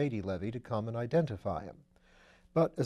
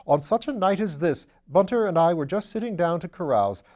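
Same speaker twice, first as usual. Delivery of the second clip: put-on higher, louder, delighted young lord; gushing welcome to an old friend; Lord Peter, eager; 5 semitones higher, 7 dB louder than usual. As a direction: exuberant aristocrat, loud and high. Lord Peter greets Parker eagerly.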